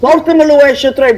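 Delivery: a hint of a bossy uncle; imitating elders. Woman imitates relatives asking for the ashtray, mock command. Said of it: "So it'd be like".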